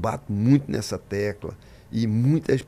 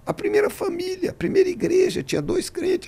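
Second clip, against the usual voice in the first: much higher, emphatic preacher's voice; emphatic preaching. Real, not acted. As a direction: preach it high to stir the listeners. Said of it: "pregação enfática". (pregação enfática em tom bem agudo)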